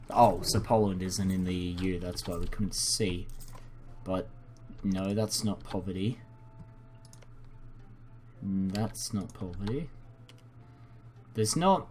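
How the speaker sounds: ordinary delivery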